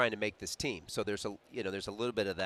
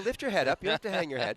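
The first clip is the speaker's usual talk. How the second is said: teasing laugh; 8 semitones higher, 3 dB louder, faster than usual. Real, not acted. Teasing partner over his remark.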